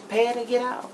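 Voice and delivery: ordinary delivery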